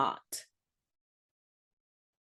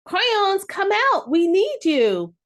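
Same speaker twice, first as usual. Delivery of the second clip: high, loud call to the crayons; boy calling to the hiding crayons, high and loud; declaring call; 9 semitones higher, 5 dB louder, slower than usual. Acted. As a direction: call them out loud and high, slowing at the end. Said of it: "the boy declared to Brown".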